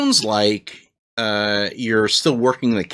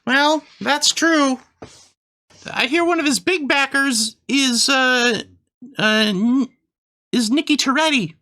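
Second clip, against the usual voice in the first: high squeaky Christmas elf; chirpy elf shopkeeper, way up high; 13 semitones higher than usual. Acted. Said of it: "I do an elf voice".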